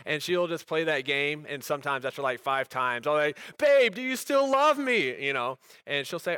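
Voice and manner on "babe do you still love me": higher, slow, whiny babe do you still love me; hammed-up high whine to his wife; mock pleading whine. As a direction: play the needy husband begging for an answer. Husband pleading with teasing wife.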